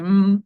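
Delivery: loud lips-together hum as a cue; humming M. Shown as a demonstration. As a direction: show the child the M with a strong mm. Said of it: "si uno le hace". (pista de la M con un mmm fuerte)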